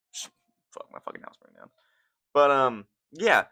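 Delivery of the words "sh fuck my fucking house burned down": unvoiced muttered curse; whispered aside. Real, not acted. Streamer reacting to a noise, whispered aside. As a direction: mutter it under your breath.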